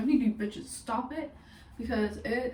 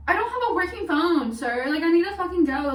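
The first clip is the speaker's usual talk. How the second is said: urgent; 7 semitones higher, 7 dB louder than usual. Retold or imitated. Urgent to clerk, running.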